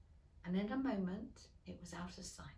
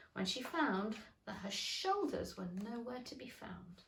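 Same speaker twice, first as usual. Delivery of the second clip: ordinary delivery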